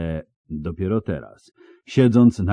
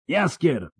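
yell; 8 semitones higher than usual. A narrator's reading. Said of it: "wrzasnął Geralt".

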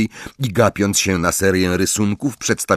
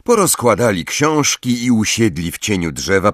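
normal talking voice

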